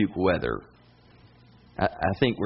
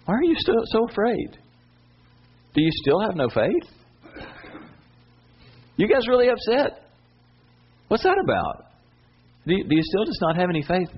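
Jesus gently chiding; 9 semitones higher than usual. Retold or imitated. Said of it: "He says to the disciples".

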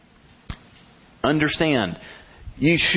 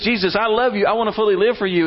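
higher, louder eager vow; Terry praying; 10 semitones higher, 3 dB louder, faster than usual. Retold or imitated. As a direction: gush like an eager believer. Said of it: "Terry says".

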